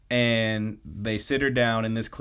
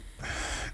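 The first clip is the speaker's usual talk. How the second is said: short, soft huff of annoyance; annoyed sigh; 11 dB quieter than usual. Retold or imitated. Hina fed up with being told it's okay, annoyed sigh. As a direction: imitate an exasperated, breathy sigh. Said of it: "just looks really annoyed".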